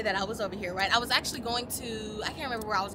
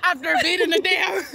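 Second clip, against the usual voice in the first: laughing; 6 semitones higher, 13 dB louder, slower than usual. Real, not acted. Laughing through words.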